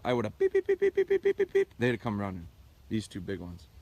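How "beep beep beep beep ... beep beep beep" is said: quick run of high piping beeps; a fast string of whistle beeps for the recall; rapid whistle beeps. Shown as a demonstration. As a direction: rattle off whistle blasts to call dogs in. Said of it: "if I had a whistle".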